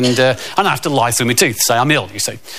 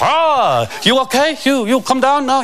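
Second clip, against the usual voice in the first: put-on higher, broken-English Swedish accent; 10 semitones higher than usual. The Swedish buddy calls to him, broken-English Swedish accent. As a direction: Eric checks on his panicking buddy, Swedish accent. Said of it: "And Eric pops up".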